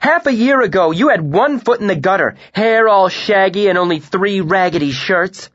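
very loud, high furious rant; sustained shouted scorn; furious tirade. A narrator's reading. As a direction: tear him down.

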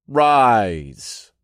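ringing command. A narrator's reading. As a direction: command the whole room, letting the word ring out. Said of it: "I commanded once more".

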